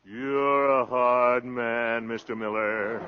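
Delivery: very slow, stretched-out flattery; slow sly drawl. Acted. Salesman oozing at Mister Miller, slow and sly. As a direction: drag it out slyly. Con man flattering, drawn out.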